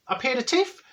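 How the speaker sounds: puzzled echo. Acted. Querying the friend's fancy word, puzzled. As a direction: echo it back as a baffled question.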